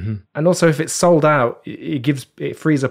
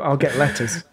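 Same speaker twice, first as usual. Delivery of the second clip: ordinary talk, nothing marked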